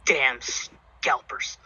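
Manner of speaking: low grumble under the breath; muttering. Acted. Angry drunk boss grumbling over his notebook, muttering. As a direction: mutter it to yourself, softly and sourly. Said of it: "like, muttering to himself".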